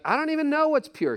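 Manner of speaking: high-pitched imitation of a baffled listener; mock protest. Preacher playing a listener objecting to the advice, mock protest.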